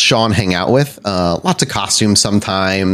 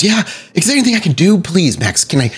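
worried Adelaide; 9 semitones higher than usual. Acted. Adelaide worried, offering help.

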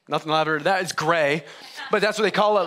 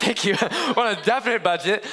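laughing; 4 semitones higher than usual. Real, not acted. Laughing at own budget joke.

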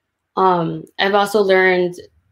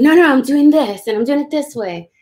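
higher, stubbornly insisting on her own way; mock-stubborn young artist, pitched up; insistent self-quote; 6 semitones higher, 4 dB louder than usual. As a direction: replay her younger self digging in her heels. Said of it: "I go left and I'm like".

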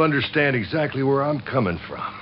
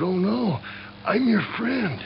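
higher, lightly voiced rabbit plea; a hint of a scared little rabbit; pleading rabbit; 8 semitones higher, 3 dB quieter, slower than usual. Rabbit begging the snake, mildly higher plea.

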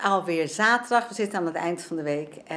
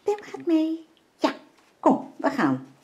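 high, cooing pet voice; talking to the dog; 10 semitones higher, slower than usual. Real, not acted. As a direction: tell the dog the happy news. (hoge koerende hondenstem)

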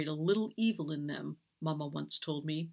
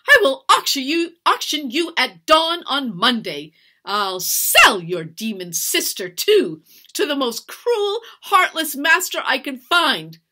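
loud, high raging tirade; raised, emphatic vengeful rant; screamed threats; 7 semitones higher, 11 dB louder than usual. A narrator's reading. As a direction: shout threats in a fury. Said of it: "she screamed".

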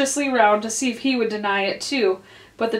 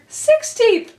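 Dormouse voice; 13 semitones higher, 4 dB louder than usual. A narrator's reading. Dormouse answers.

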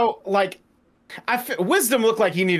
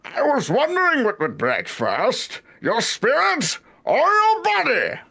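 slow, heavy villain voice; menacing masked villain, drawn out; Bane impression; 8 semitones higher, slower than usual. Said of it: "Someone said to impersonate Bane from Batman".

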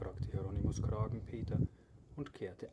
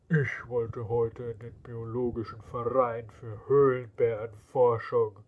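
louder, cross complaint; angry grumble; 8 dB louder than usual. Acted. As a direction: grumble with open anger. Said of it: "sagte er böse".

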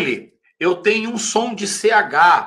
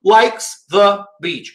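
Likes the beach, slow with a slight gap; slow careful model; slow demo; much slower than usual. Shown as a demonstration. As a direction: say it slowly, words apart. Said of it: "Olha só".

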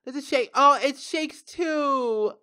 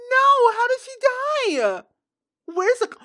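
high, loud, crying question; tearful disbelief; 13 semitones higher, 8 dB louder than usual. Real, not acted. Gamer asks how her Sim died, crying. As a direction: cry out asking how it happened. Sim wife dies suddenly.

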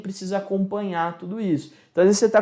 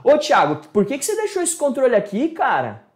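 annoyed scolding tone; 5 semitones higher than usual. Shown as a demonstration. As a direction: scold a friend for leaving things around. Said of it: "Ó o tom de voz, que já é diferente".

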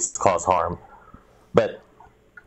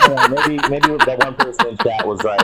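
talking through laughter, pitched higher; words bubbling with laughter; laughing while conceding; 14 semitones higher, 4 dB louder than usual. Real, not acted. Laughing after dark joke.

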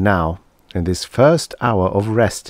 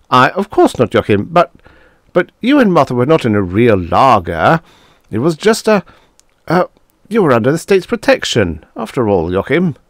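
higher and louder, stammering; flustered Tanzler; 6 semitones higher, 5 dB louder than usual. Acted. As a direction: scramble for an excuse. Tanzler taken aback, flustered.

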